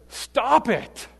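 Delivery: sharp, high-pitched telling-off; scolding pastor. Retold or imitated. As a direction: snap it as a pastor rebuking a sinner. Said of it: "If his pastor had said".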